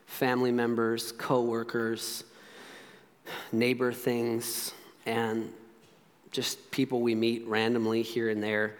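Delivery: low, soft prayer with long pauses. Real, not acted. Preacher praying for hurting relationships, low and unhurried prayer. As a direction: pray quietly, haltingly, a phrase at a time.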